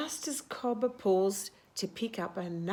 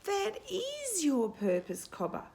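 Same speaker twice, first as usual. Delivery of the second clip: wisest voice; 10 semitones higher than usual. Acted. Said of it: "in her wisest voice".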